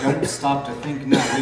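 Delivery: normal talking voice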